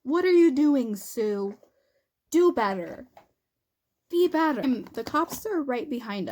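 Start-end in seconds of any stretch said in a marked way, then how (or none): 0.0-4.6 exasperated scolding. Retold or imitated. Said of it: "And I'm like".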